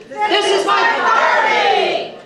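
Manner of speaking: high, loud and slow group yell. Pretend. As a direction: belt it out like a rally chant. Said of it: "you're gonna yell out".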